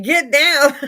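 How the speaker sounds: shouted high and loud; yelling. Retold or imitated. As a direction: bark the order to get down.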